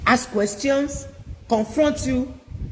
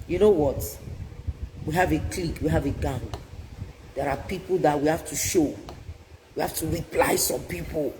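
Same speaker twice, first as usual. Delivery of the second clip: lower, softer voice of peer friends; imitating kids' friends, a bit lower; voiced teen friends; 6 semitones lower, 4 dB quieter, faster than usual. Preacher voices friends luring kids, lower and softer.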